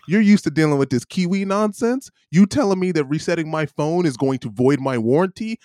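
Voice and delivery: high-pitched, incredulous telling-off; indignant retold rant. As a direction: challenge them as if the claim is absurd.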